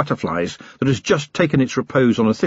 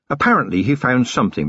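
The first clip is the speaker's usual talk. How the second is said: usual speaking voice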